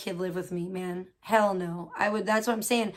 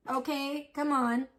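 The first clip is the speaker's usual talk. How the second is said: usual speaking voice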